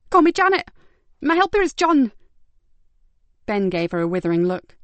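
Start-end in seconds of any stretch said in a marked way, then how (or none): 0.1-2.1 high, loud, defiant; evasive. Acted. Carer brushing off the detective's question, defiant. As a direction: brazen fake names.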